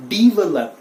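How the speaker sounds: "develop" loud, opening with DEE; correct pronunciation. Shown as a demonstration. Teacher correcting learners, develop with a DEE opening. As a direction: say develop as dee-VEH-lup. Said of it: "वो ई के sound में आएगा".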